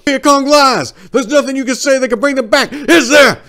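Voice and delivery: very high, very loud, fast furious shout; raging shout. Acted. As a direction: scream while choking him. Soldier strangling enemy, shouting.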